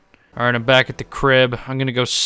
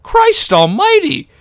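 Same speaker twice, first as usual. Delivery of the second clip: loud, high, slow "Christ almighty!"; a real jolt of disbelief; shocked cry; 7 dB louder, slower than usual. Real, not acted. Gamer reacting to a tiny driver with a loud, slow cry.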